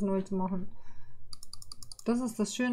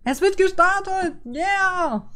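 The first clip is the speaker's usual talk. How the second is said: much higher and louder, a burst of joy; excited cheer; 9 semitones higher, 5 dB louder, much slower than usual. Real, not acted. Streamer to viewers when the game finally starts, excited cheer. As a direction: cheer like the wait is finally over.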